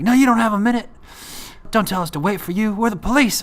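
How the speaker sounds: high, loud, barking police voice; police refuse to let him pack his things, loud and stern; mock angry cop.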